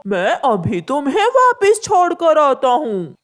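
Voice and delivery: evil cackle. Acted. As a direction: gloat as you trap him. Crow's evil laugh.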